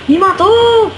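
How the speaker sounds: high, loud outraged yelp; indignant outcry. Real, not acted. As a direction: cry out at being killed. Killed in the game. (grito indignado)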